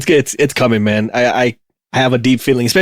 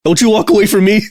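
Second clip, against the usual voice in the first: heated fight shouting; 8 semitones higher than usual. Retold or imitated. Scolding the fleeing robot.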